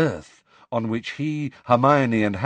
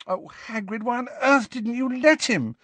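high, anguished reproach; exasperated dismay; 14 semitones higher than usual. A narrator's reading. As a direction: implore him, despairing. Hermione dismayed, reproaching Hagrid.

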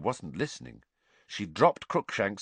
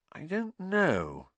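drawn-out, higher, doubtful; slowly; 11 semitones higher, much slower than usual. A narrator's reading. Hermione unsure about the gift, speaking slowly. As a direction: stretch the words out with hesitation. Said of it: "said Hermione slowly".

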